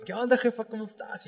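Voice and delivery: butter up mom, lightly; sweet-talking mom. Retold or imitated. Son phoning mom before confessing, lightly sweet. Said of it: "Acá le hablas bien chido".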